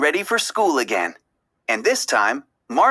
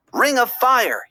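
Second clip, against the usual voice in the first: battle cry; 8 semitones higher than usual. A narrator's reading. Gil calling his plan to the tank gang, battle cry. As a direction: shout the signal to the gang. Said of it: "he cried".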